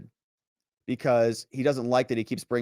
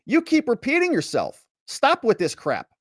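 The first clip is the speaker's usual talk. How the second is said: higher, exasperated snap; upset retort; 11 semitones higher than usual. Retold or imitated. Witness snapping at the prosecutor, upset and exasperated. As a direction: shut down the repetition, fed up. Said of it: "And Robbins is upset".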